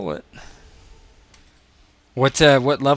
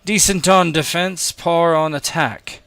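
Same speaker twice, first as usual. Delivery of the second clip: loud, higher voice of a master passing judgement; appraising Dominus; 6 semitones higher, 5 dB louder, slower than usual. Acted. Dominus judging a gladiator's fight, loud appraisal. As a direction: pronounce the verdict loudly, slowing at the end. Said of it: "the Dominus is like".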